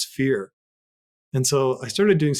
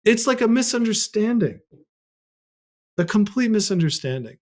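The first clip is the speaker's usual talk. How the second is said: elated realization; 9 semitones higher, 4 dB louder than usual. Retold or imitated. Sudden realization after ceremony.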